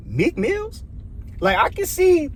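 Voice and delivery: high-pitched, incredulous question; shocked disbelief. Retold or imitated. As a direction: say the name as if you can't believe it. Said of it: "I was really shocked by that".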